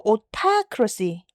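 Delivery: 오타크러시, slow, with a clear T on 타; hard T. Shown as a demonstration. Teacher showing an exception to flapping, 오타크러시 with hard T. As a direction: keep the T crisp in 오타크러시. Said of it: "T로 발음되거든요".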